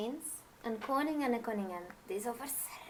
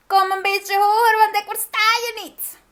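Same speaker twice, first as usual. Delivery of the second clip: high, much louder croaking witch voice; old witch; 12 semitones higher, 13 dB louder than usual. Acted. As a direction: screech down from the treetop like an old hag. Witch calls down to queen.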